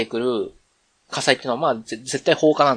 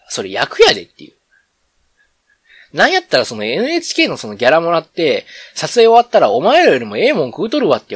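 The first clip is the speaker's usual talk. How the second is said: brisk Kansai-dialect retort, a bit louder; slipping into Kansai dialect; 3 semitones higher, 5 dB louder than usual. Real, not acted. Scoffing at viewers who mail food to actors, in Kansai dialect. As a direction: let Kansai dialect take over as you scoff. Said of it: "めっちゃ関西弁出ちゃったけど".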